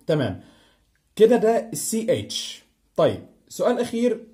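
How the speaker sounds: ordinary talk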